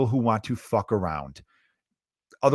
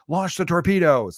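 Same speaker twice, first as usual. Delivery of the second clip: lightly acted command, pitched up; movie submarine commander; 6 semitones higher, 4 dB louder than usual. Comic mimics a submarine crew in a naval battle, mock command. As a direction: call it out like a movie naval officer. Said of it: "there's a submarine being like".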